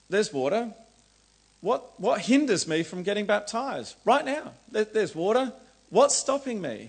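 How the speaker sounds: high, loud eager outburst; fired up. Retold or imitated. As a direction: burst out with eager excitement. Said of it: "he's all fired up".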